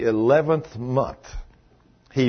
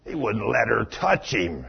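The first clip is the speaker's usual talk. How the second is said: disdainful Pharisee; 9 semitones lower, 9 dB quieter than usual. Retold or imitated. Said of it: "guy sitting there says".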